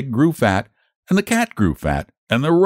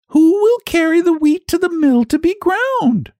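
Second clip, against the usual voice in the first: hen calling out. Acted. Hen asks who will carry wheat to the mill, high call. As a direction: call across the barnyard. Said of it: "she called out".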